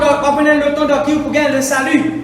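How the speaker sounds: loud, high fervent sermon cry; shouting the call to open ears to God; full-force preaching. Acted. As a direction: preach it out to the whole church. Preacher exhorts congregation.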